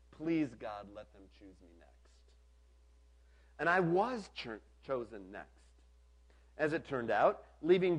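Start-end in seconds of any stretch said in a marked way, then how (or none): 0.1-2.1 hushed, dropping low and fading away; breathed prayer. A narrator's reading. Camper praying under his breath to be picked, hushed. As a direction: breathe it out as a private plea. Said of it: "I breathed a horribly inappropriate prayer".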